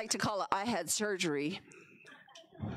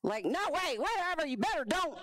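a touch of stern grandma; 6 semitones higher than usual. Retold or imitated. Grandma's voice in her head warning her, lightly stern. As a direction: scold like a firm Navajo grandma. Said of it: "my grandma's voice and my auntie's voice".

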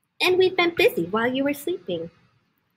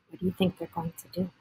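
softer, fast worried question; hushed aside; 5 semitones lower, 9 dB quieter, much faster than usual. A narrator's reading. Reading to a child, hushed worried aside. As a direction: ask it quietly, leaning in.

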